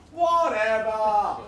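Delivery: long drawn-out, falling "whatever"; big slow shrug of a word; dismissive refrain. Acted. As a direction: stretch both halves slowly and let it drop. Repeated refrain of performance poem.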